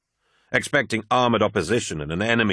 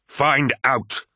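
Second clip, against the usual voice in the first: raised, higher-pitched command; commanding order; 6 semitones higher, 3 dB louder than usual. A narrator's reading. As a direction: order it firmly. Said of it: "ordered the War Master".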